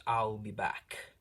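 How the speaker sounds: slow, lightly put-on movie robot line; a hint of the famous action-hero farewell, drawn out; movie reference quote. Lucifer leaves into the fire with a slow movie catchphrase. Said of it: "If you get that reference".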